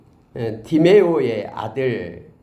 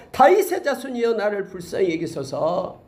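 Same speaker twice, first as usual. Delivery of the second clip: louder cry; 6 semitones higher than usual. Retold or imitated. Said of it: "더욱 크게 소리질러".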